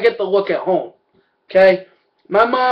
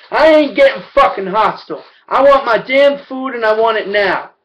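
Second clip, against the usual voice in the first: loud, higher, furious at the counter; angry outburst; 5 semitones higher, 4 dB louder than usual. Retold or imitated. Retelling yelling at the McDonald's cashier, loud and furious. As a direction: snap back, raising the voice.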